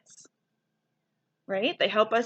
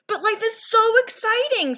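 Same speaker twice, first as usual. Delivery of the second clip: high, bursting with excitement; excited; 10 semitones higher than usual. A narrator's reading. Clark protesting to his teacher, excited. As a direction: gush like life can't wait. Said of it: "Clark protests excitedly".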